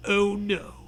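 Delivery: usual speaking voice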